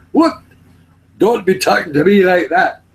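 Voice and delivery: high, loud mock-Scottish protest; put-on Scottish telling-off; mimicked Scottish accent. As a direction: copy an indignant Scotsman. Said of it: "Talk the same".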